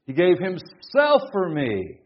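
"himself" lifted high and loud; slow, with "himself" hit hard and "for me" falling away; stress on "himself". Shown as a demonstration. Preacher showing emphasis on the verse, stress on "himself". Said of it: "Anywhere you put the emphasis".

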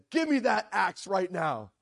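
slow, loud mock command; retold yell. Missionary facing an armed prisoner in a riot, retold yell. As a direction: imitate her yelling a firm order, each word weighted. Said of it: "yells at him and said".

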